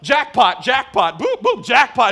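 high, booming mock machine announcement; loud imitated arcade celebration; arcade jackpot call. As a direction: shout it like a machine paying out over and over.

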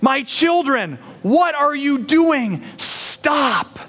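high, urgent loving father calling out; Jesus pleading. Acted. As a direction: plead hard with children you love. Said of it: "Jesus coming into the temple saying".

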